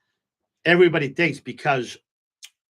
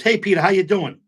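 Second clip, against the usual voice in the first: cheery greeting; 6 semitones higher, 5 dB louder than usual. Retold or imitated. Friend greeting him at the club.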